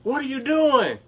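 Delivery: high, quizzical coworker voice; mock coworker asking. Coworker voiced in workplace story.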